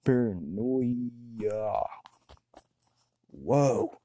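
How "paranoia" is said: "Paranoia" stretched very slowly with a falling end; slow savoring. Shown as a demonstration. A stoner noting a word to himself, stretched out slowly. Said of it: "I gotta write this shit down".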